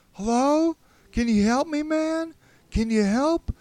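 high, loud calls for help; calling out. A narrator's reading. Stranger calling out for help, high shouting. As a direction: shout far down a corridor for someone to help you. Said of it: "heard someone calling out".

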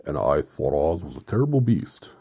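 loud, swooping character voice; wrong character voice. Acted. Reader mistaking whose line it is, loud swooping voice. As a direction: boom it out with a big swoop on beast. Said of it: "Oh, that was the Woodman".

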